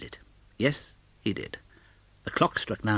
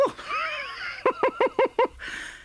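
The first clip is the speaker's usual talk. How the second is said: very high shouted whoop of laughter; joyous whoop and laugh. Acted. Scrooge whooping with delight at his own surprise. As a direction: let out a giddy cry of joy.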